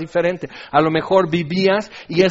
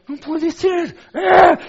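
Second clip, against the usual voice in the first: high, aghast exclamation; loud, high-pitched acted outrage; mock shock; 12 semitones higher, 7 dB louder, slower than usual. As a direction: cry out in hammed-up disbelief. Acts out Finés's shocked reaction.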